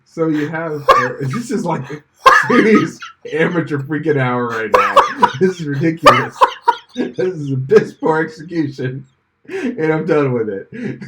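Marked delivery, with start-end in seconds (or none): 2.5-11.0 laughing. Real, not acted. Laughing at his own blunder.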